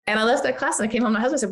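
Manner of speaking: normal talking voice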